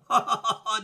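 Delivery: loud, high staged villain laugh; acted robbers' jeering ha-ha-ha; mocking laugh. A narrator's reading. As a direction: laugh scornfully in even bursts. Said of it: "dirent les, va-, les voleurs".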